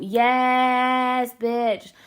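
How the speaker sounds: sassy loud approval; cheering yes. Retold or imitated. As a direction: hype it up like a friend cheering. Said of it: "And I thought".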